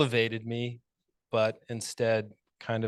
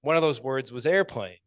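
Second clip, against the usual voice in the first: normal talking voice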